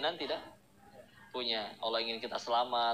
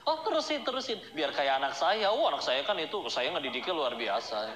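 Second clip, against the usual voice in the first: bragging mother. Retold or imitated. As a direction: show off your child shamelessly. Bragging mother about her child.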